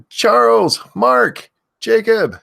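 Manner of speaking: loud high name shout; screaming names. Real, not acted. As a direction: belt the viewer's name out. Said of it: "I have to stop screaming that".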